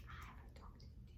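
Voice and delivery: whispered, quick at the end. Pretend. Teacher whispering to the children about the doctor toy. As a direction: breathe it to the children.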